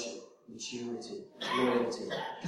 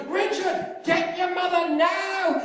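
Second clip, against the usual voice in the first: a much higher, slow desperate yell; injured father urgently yelling for his son; screaming for help; 14 semitones higher, 4 dB louder, slower than usual. Retold or imitated. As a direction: drag the order out in pain. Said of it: "He's screaming".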